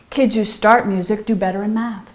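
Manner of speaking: ordinary talk, nothing marked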